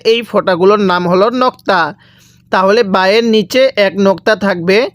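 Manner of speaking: usual speaking voice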